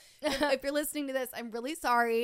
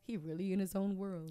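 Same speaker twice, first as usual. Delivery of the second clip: a hint of quoting the audio; TikTok audio quote; 6 dB quieter than usual. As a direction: recite it like the meme. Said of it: "isn't that a TikTok audio".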